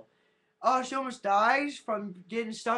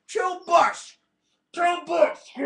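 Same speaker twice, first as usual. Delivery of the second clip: higher, louder goofy voice on "Chill bus"; silly put-on voice; 7 semitones higher, 7 dB louder, much slower than usual. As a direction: say "Chill bus" in a squeezed cartoon voice. Mock voice, "can't understand me.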